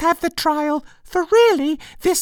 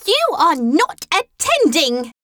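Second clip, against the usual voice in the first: higher, slow scolding; severe; 7 semitones higher, 4 dB louder, slower than usual. A narrator's reading. The mouse to inattentive Alice, severe. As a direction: scold word by word, pitched up. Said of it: "said the mouse to Alice severely".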